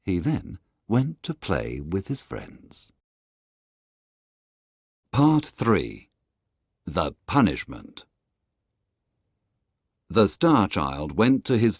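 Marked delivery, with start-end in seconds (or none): none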